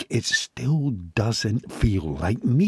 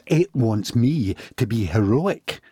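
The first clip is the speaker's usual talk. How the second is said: ordinary delivery, nothing marked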